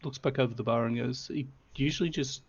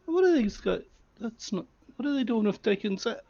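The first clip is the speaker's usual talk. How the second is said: much higher and louder, indignant surprise; surprised bartender; 9 semitones higher, 4 dB louder than usual. Acted. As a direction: start to object and break off. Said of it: "he starts to say and pauses".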